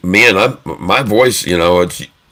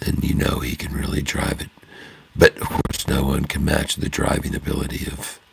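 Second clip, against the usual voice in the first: hushed golf commentary, softer; 2 semitones lower, 6 dB quieter than usual. Pretend. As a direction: murmur the shot like a golf announcer on TV. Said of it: "I often do the golf voice".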